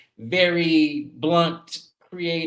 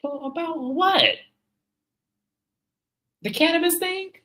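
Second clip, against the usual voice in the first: incredulous retort. Retold or imitated. Replying to creators who say [name] lies, incredulous. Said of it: "And I'm like".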